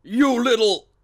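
loud, high curse cut short; angry outburst. Acted. Inspector giggled at by a small girl, angrily shouting. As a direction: start to curse a cheeky runaway.

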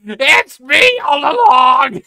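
slow, high, loud line cracking with laughter; Kat's reveal, half-sobbing with laughter; laughing reveal shout. Acted. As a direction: shout it through tears of laughter. Repeating reveal while laughing.

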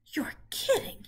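high whispered piglet gasp; breathless high disbelief; Wilbur amazed. Acted. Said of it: "he said".

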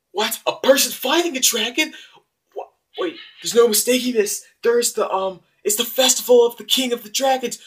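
high, loud panicked shrieking; panicked freakout. Acted. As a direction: freak out like the world is ending. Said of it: "you might wanna calm down there".

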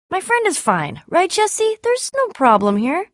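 high-pitched, insisting all is fine; nervous reassurance. Acted. Covering nervously for friend during deal.

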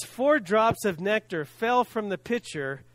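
ordinary delivery

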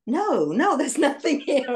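frantic dismissal. Retold or imitated. Reliving flipping through the Bible, high frantic dismissal.

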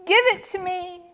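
put-on high-pitched childish demand; tantrum demand. A narrator's reading. Walrus copies Turtle's tantrum, demanding in high voice. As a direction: whine it up high. Animals fake a tantrum together.

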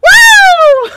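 loud shocked whoop from icy water; shocked cold whoop. Real, not acted. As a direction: yell out at the freezing shock. Ice water just poured on.

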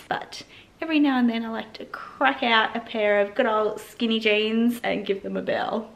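ordinary talk, nothing marked

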